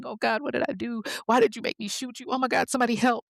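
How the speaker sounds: mildly acted panic, pitched high; a touch of frantic alarm as she realizes; understated imitated panic; panicking. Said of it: "now she's panicking".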